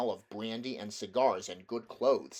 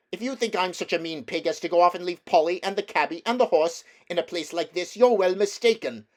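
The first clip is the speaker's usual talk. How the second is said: loud, higher, fast indignant protest; defiant boy; 8 semitones higher, 9 dB louder than usual. Acted. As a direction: fire it back at her, fast and loud. Diggory defies the witch.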